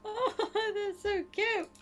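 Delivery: cooing. Real, not acted. Cooing over the cow.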